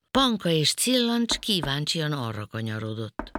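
usual speaking voice

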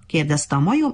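ordinary delivery, nothing marked

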